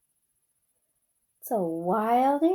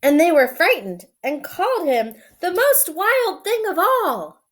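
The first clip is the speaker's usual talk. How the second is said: story reading; 4 semitones higher, 6 dB louder than usual. A narrator's reading. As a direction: read it like a bedtime story. Reads picture book aloud.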